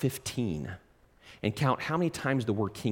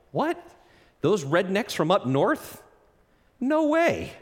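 scoffing disbelief; 6 semitones higher than usual. Retold or imitated. Disciple scoffing at Galilee.

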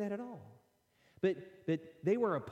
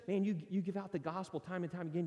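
ordinary delivery

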